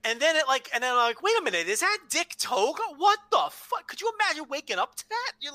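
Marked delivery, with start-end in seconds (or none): 1.2-3.9 high, climbing disbelief; astonished. Retold or imitated. Co-host retells waking up to Dick Togo, astonished. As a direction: act out a groggy fan's double take.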